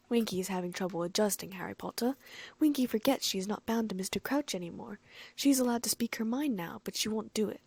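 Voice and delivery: ordinary delivery, nothing marked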